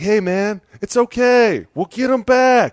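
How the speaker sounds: high, light mock reassurance; calm him down in a put-on voice; mock soothing coach.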